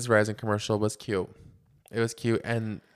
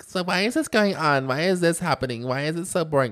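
higher, louder imitation of complainers; mock whining; 6 semitones higher, 5 dB louder than usual. Mocking people who don't know the sport, mock whining. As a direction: mimic clueless fans griping. Mimics clueless viewers complaining.

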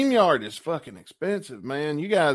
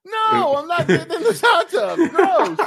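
laughing disgust; 7 dB louder than usual. Acted. Acting out a disgusted customer.

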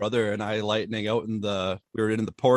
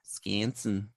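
put-on Wisconsin accent; 2 semitones lower, 7 dB quieter than usual. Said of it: "people there actually talk like that".

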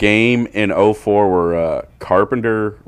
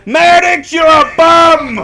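mock old heckler yelling, much higher and louder; furious heckler shout; 8 dB louder, slower than usual. Old fan heckling Maddux in the outfield, a hammed-up furious yell. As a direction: bellow it at the pitcher, slowing on the last words. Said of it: "I haven't seen somebody this mad".

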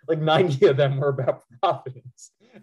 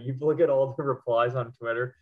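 usual speaking voice

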